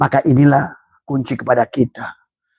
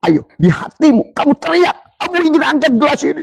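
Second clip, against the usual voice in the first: urging shout; 13 semitones higher than usual. Retold or imitated. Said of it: "Maka Abah bilang ke yang setruk itu".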